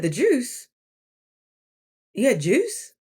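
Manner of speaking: incredulous elder asking; surprised. Retold or imitated. Elder coworker quizzing her diet.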